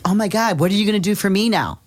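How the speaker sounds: high, fast, grabby whine; mock needy voice. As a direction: play the selfish helper asking what's in it for them. Mocking a needy, grasping helper.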